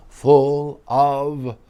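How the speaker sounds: spooky shout. Acted. Spooky reveal of the ghosts.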